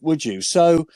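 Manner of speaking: ordinary talk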